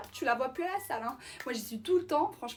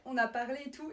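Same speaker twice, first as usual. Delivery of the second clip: normal talking voice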